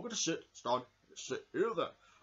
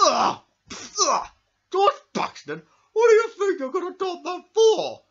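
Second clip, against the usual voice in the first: shrieking disgust, much higher and louder; outraged yell; 13 dB louder than usual. Acted. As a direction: scream at George for the prank. Puppet outraged at prank.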